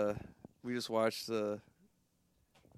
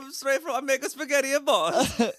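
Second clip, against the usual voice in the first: very high, loud cartoon Italian; mock Italian accent; 13 semitones higher, 5 dB louder than usual. Co-host joining the Boston spaghetti bit, high mock Italian accent. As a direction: squeal out a silly Italian accent.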